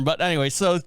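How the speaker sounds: usual speaking voice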